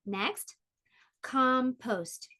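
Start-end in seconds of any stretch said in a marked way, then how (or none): 1.2-2.3 "compost" in two even beats; teacherly syllable-by-syllable "compost"; split into syllables. Shown as a demonstration. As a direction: say "compost" as com, post. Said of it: "divide words into syllables".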